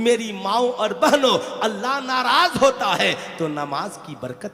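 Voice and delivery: loud sermon building to a high shout; forceful, swelling preacher's cadence; impassioned preaching. Real, not acted. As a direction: drive each warning home, rising to a climax.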